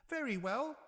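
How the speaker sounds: very high pig voice; a cheerful small pig agreeing; little pig. A narrator's reading. Third pig agreeing to the wolf, high voice. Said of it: "said the little pig".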